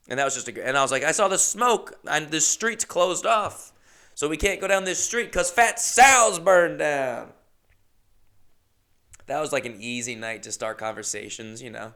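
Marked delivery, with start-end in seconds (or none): 1.0-7.3 raised, high, emphatic voice; worked-up eyewitness account; excited reporting. Retold or imitated. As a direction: relay the drama with rising urgency. Acted excited retelling to passengers.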